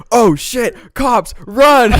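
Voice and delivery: loud high panicked yell; sudden high shout to flee the cops. Retold or imitated.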